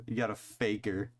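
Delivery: ordinary delivery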